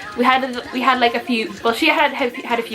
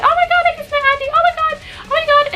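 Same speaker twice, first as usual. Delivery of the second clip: high, fast, breathless squeal of a starstruck fan; fangirling squeal; 3 dB louder, faster than usual. Retold or imitated. Fans after meeting the band squeal in disbelief. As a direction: gush like you just met your idol. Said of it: "everyone was fangirling".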